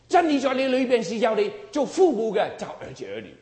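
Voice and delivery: high, loud preaching; loud exhortation. Real, not acted. Preacher tells parents to teach children, loud exhortation. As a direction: drive the truth home hard.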